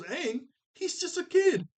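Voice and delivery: very high, squeaky disbelief; incredulous mock squeal. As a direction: jump way up in pitch, hammed-up protest. Acting out Sokka's incredulous objection.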